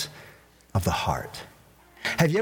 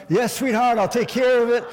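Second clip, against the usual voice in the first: higher, louder sugary yes to hide frustration; forced sweet reply; 9 semitones higher, 5 dB louder than usual. Retold or imitated. Pastor answering his wife sweetly while fuming inside. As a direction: smile through gritted teeth. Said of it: "I'm this turmoil... but I'm smiling".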